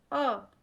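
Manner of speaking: अ held long and slow, tongue middle dipped; teacher modelling the mid vowel; drawn-out a. Shown as a demonstration. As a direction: stretch out the अ slowly for the class. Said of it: "जब भी हम अ का उच्चारण करते हैं".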